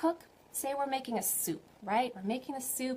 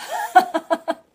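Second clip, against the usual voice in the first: high laugh; 10 semitones higher, 16 dB louder than usual. Real, not acted. Laughs after child story.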